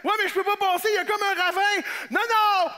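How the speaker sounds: hollered long-distance exchange; shouting across ravine. Acted. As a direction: call over a wide gap.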